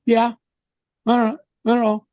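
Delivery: mimicking a curt, evasive official; short mock replies, giving nothing away; imitated brush-off. Official stonewalling the caller, imitated brush-off. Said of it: "He's talking like this to me".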